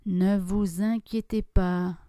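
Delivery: usual speaking voice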